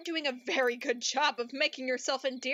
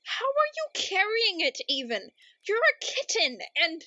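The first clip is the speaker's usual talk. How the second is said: incredulous; 6 semitones higher, 4 dB louder than usual. Acted. Narrator baffled by armed kitten.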